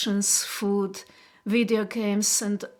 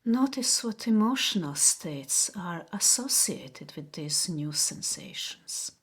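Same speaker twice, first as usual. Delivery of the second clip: guided meditation. Acted. Host guiding viewers to notice body sensations, guided meditation. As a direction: guide them gently inward, unhurried, leaving space after each phrase. Leads a relaxation exercise.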